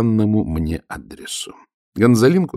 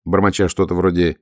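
usual speaking voice

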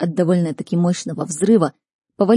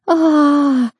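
long high loud "aah", sliding down; wailing scream; 9 semitones higher, 5 dB louder than usual. A narrator's reading. Chloe backing away from dead hands, high wailing scream. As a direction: scream out in terror, held long. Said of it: "заголосила Хлоя".

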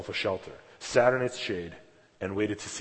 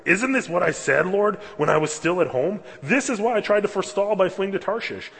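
higher, louder angry prayer; in anger; 9 semitones higher, 6 dB louder than usual. Retold or imitated. Preacher voicing Jonah's angry prayer, raised and heated. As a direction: protest hotly to God. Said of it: "he's saying it in anger".

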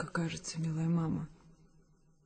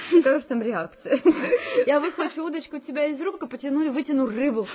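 laughing; 5 semitones higher than usual. Acted. Daughter laughing at imagined scene.

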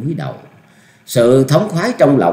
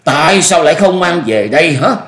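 high, loud angry reproach; teeth-grinding anger; 7 semitones higher, 5 dB louder than usual. A narrator's reading. Old master rebuking his junior's failure, angry. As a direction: demand why through gritted teeth. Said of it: "Ông ta nghiến răng nói".

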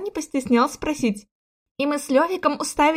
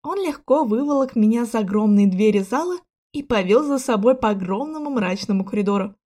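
plain reading voice

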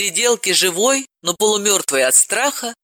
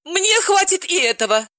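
high, gushing exclamations; excited Galina; 7 semitones higher than usual. Acted. Galina exclaims over the news, high and gushing. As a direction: gush like a giddy friend. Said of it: "воскликнула".

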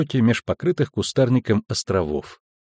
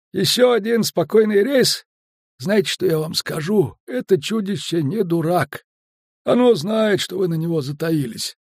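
higher-pitched, folksy storyteller skipper; chatty skipper; 6 semitones higher than usual. A narrator's reading. Skipper telling Geralt about a river otter, chatty and folksy. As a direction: spin a village yarn as the talkative skipper.

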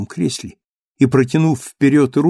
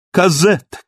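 raised call across the garden; 6 semitones higher, 4 dB louder than usual. Acted. Marius rushing into the garden, first urgent call. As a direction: call her name urgently. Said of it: "кричал он".

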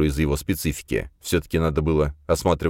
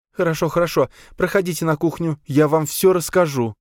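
high, pleading grieving mother; higher, begging for hope; 12 semitones higher than usual. Acted. Mother hurries guests in.

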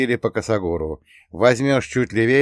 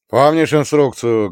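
loud, raised military bark; barked question; 4 semitones higher, 6 dB louder than usual. A narrator's reading. Rakhimov checks the drone instructions, barking loudly. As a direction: bark it at a subordinate. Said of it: "гаркнул Рахимов".

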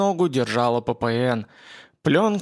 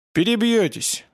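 gruff grumble; 7 semitones higher than usual. A narrator's reading. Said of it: "буркнул Влад".

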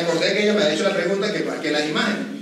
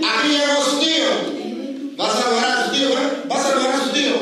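sister on video call; 5 semitones higher than usual. Retold or imitated. Sister urging her son to greet his uncle, higher and coaxing.

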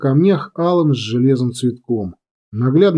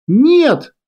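high-pitched cry of protest; horrified cry; 11 semitones higher, 4 dB louder than usual. A narrator's reading. Girl seeing bombers fired on, horrified cry. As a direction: cry out as disaster strikes. Sees ambush fire on bombers.

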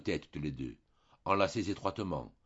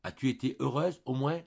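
stammered question; 6 semitones higher, 3 dB louder than usual. A narrator's reading.

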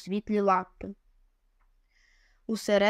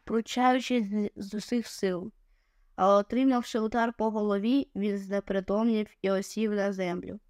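ordinary delivery

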